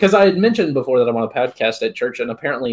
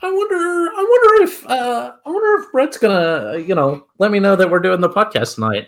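high musing inner voice; high wondering voice; 8 semitones higher than usual. Retold or imitated. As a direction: wonder aloud, pitched up. Retold own thoughts.

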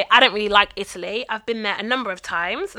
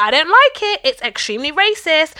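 emphatic; 8 semitones higher, 4 dB louder than usual. Real, not acted. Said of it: "her own insistent warning".

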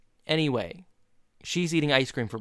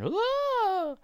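high slipping yelp. Retold or imitated. Character slips in bathroom.

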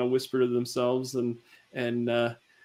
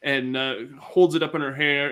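ordinary talk

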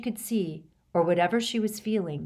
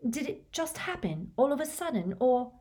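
gentle; 6 semitones higher than usual. A narrator's reading. Hermione to Katie's wailing friend, gently probing. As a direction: coax the story out gently.

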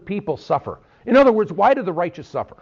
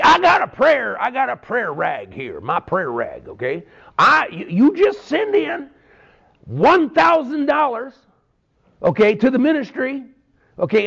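put-on TV preacher accent; 7 semitones higher than usual. Pastor mocking a TV evangelist selling prayer rags, hammed-up evangelist accent. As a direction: lay on the televangelist accent, selling hard. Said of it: "they all talk in that type of accent".

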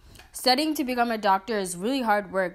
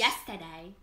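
put-on playful Irish accent try; mock Irish accent; 4 semitones lower, 4 dB quieter, slower than usual. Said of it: "Do Irish".

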